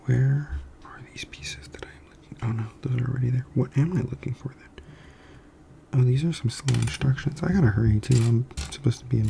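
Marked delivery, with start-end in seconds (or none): 0.0-3.5 whispering. Real, not acted. Builder whispers to herself, looking for pieces. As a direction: whisper while hunting pieces.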